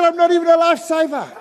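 high, loud comic protest; hammed-up indignant cry; incredulous protest. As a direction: shout it in mock disbelief.

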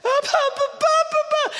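put-on high, wailing little-girl sob; mock sobbing child. Grandfather imitating his sobbing granddaughter. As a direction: wail his name like a crying five-year-old. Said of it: "she starts crying, like, sobbing".

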